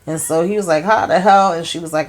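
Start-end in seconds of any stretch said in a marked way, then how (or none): 0.8-1.5 stunned disbelief. Retold or imitated. Richard stunned by news.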